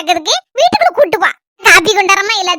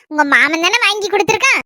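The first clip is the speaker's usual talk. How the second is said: ordinary talk, nothing marked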